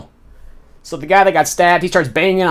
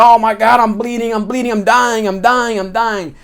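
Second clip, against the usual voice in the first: panicked; 5 semitones higher than usual. Retold or imitated. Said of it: "He's like".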